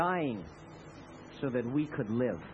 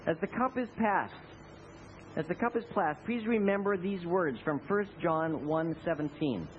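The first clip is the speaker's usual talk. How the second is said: ordinary talk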